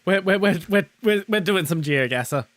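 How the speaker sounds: loud, stammering start; loud stutter. Acted. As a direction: stumble loudly into it.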